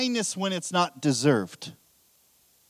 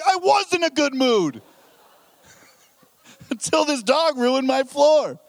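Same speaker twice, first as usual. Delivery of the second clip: high, loud comic outburst; indignant; 9 semitones higher, 7 dB louder than usual. Retold or imitated. Husband answering wife's remark on his mood, indignant. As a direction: snap back in hammed-up outrage. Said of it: "you're in a really great mood".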